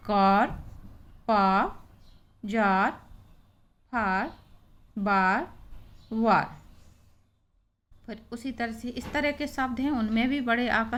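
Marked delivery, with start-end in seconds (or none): 0.1-6.6 each word, कार to वार, ending on a barely sounded R; light final R. Shown as a demonstration. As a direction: model कार, जार, फार, बार, वार with the R kept light. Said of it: "हल्की sound रखी जाती रा की".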